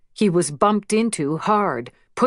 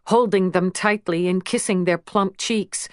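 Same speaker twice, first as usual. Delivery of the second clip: ordinary delivery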